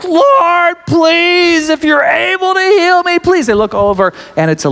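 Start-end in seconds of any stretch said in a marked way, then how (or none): 0.0-3.5 high, strained beggar's plea; guttural call. Acted. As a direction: call out desperately for healing, acted. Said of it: "this guttural".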